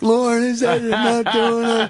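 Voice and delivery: high, slow, panicky pleading; panicked plea. Retold or imitated. Comic begs the producer, high and panicky. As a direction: beg in mock alarm.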